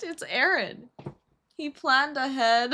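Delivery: high, slow tease tipping into laughter; amused teasing. Real, not acted. Friend teasing that he planned it, laughing. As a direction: draw it out, then burst out laughing.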